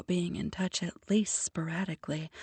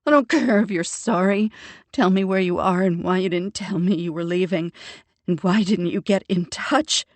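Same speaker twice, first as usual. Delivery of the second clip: angry shouting; 9 dB louder than usual. Acted. Sister still yelling at brother.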